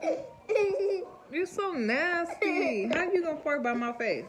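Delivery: high, loud words through laughter; laughing disgust. Real, not acted.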